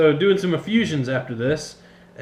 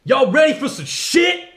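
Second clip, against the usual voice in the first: high, loud hype intro; announcer voice; 11 semitones higher, 6 dB louder than usual. Acted. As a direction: shout it like a show opener. Hyped show intro announcer voice.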